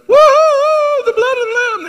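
very high, loud shrieking woo-hoo; hammed-up ecstatic church ladies; Pentecostal old ladies. Preacher imitating old ladies in church, high ecstatic shriek. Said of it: "they're going".

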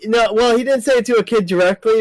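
normal talking voice

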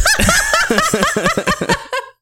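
high, loud laugh breaking out; burst of laughter. Real, not acted. Laughing hard at the roommate's jab. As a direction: crack up at the example line.

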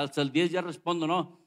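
ordinary talk, nothing marked

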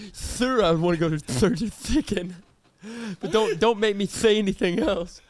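"church's chicken" said as "thirth's thicken", CH turned to TH; pleading customer with an exaggerated lisp on "church's chicken"; mock lisp. Friend acts out lisper ordering church's chicken, mock-lisping. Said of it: "I'm like".

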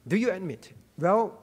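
usual speaking voice

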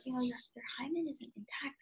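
quiet fast gynecologist voice; hushed. Retold or imitated. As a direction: murmur it quickly and softly.

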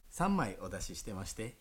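usual speaking voice

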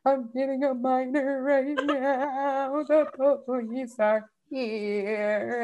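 slow, high, tearful wail; sobbing it out, dragging every word; retold crying. Calling her mom at one thirty in the morning, mock tearful wail. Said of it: "one thirty in the morning crying".

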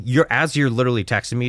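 usual speaking voice

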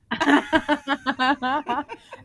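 high, loud real laugh; big rolling laugh; loud burst of laughter. Real, not acted. Host laughs about mug.

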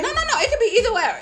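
high, loud, laughing protest. Real, not acted. Arguing the point, high and laughing.

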